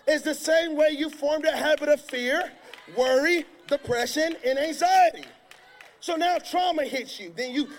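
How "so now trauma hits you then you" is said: ordinary talk, nothing marked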